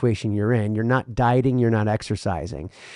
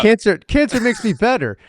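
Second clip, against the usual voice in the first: silly put-on voice; 9 semitones higher, 4 dB louder than usual. Joking put-on voice.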